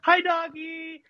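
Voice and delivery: very high, sweet voice for a dog; high doggy greeting. Retold or imitated. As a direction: coo at the dog. Brother greets dog mid-count.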